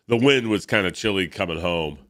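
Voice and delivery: ordinary talk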